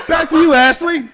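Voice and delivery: mock TV anchor handing off; newscaster voice.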